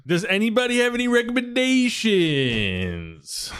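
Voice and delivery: a lazy, sing-song ask to everyone. Pretend. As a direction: draw the question out long and high. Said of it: "drawn-out call to the group".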